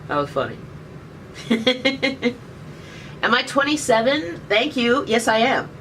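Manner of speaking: ordinary talk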